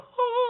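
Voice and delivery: very high falsetto ah; falsetto cry. Shown as a demonstration. Coach showing male students the falsetto, high falsetto ah. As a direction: flip up into falsetto. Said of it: "we also have our falsetto".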